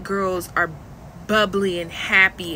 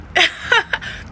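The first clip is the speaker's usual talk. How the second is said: high laugh; 10 semitones higher than usual. Real, not acted. Laugh after the parody.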